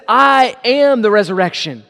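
"I am" punched, each word set apart; stress on I AM. Shown as a demonstration. Jesus says what he is, not was, emphatic "I am". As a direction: hit "I am" hard, as a correction. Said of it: "Rather, he says".

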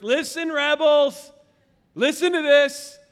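loud, high call for attention; urgent preaching. Real, not acted. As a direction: slowly summon the rebels to listen. Preacher urging congregation.

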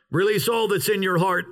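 normal talking voice

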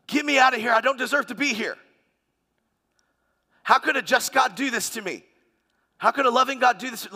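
preacher voicing the rich man's indignant protest; pleading outcry. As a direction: cry out against the unfairness.

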